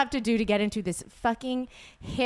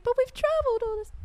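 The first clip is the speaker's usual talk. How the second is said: much higher, fast pleading voice; Wizard of Oz plea; faster than usual. Retold or imitated. Dorothy pleading at the Emerald City door, high and fast. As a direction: squeak it out like a pleading Oz traveler. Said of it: "I went too far with that".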